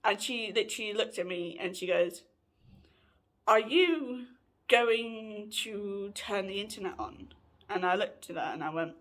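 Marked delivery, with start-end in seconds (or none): 3.5-7.3 slow, drawn-out question; slow retold request. Housemate asks about the internet after power cut, slowly. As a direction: ask it slowly, stretching the words.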